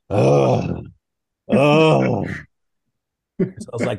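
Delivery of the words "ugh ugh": exasperated groan. Retold or imitated. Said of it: "holding his head in his hands like".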